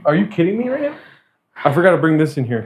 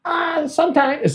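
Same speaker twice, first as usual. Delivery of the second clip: high, whiny, hesitant mock reply; timid mumble imitation; 4 dB quieter, much slower than usual. Barista dodging being a Kings fan, mocked timidly. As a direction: mimic a scared guy dodging the question. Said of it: "so he goes".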